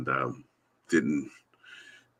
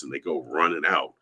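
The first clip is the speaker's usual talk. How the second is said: ordinary talk, nothing marked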